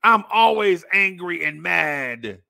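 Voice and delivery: loud, slow mocking bellow; hammed-up, drawn-out angry declaration; mock perpetual anger. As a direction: imitate someone who is always outraged. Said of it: "take that and drive it to a".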